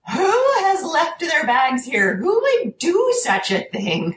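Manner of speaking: high, slow, hammed-up outrage at abandoned bags; mock indignant scolding. Clerk scolding the room about abandoned bags, high hammed-up indignation. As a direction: play the prissy clerk scolding nobody. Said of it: "because I am a tremendously vocal person".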